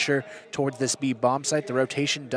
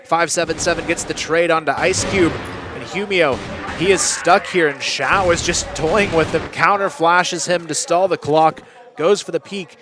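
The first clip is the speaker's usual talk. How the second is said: excited play-by-play, rising and louder; esports caster; 3 semitones higher, 5 dB louder than usual. Real, not acted. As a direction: call the clutch with building hype. Live play-by-play of a retake.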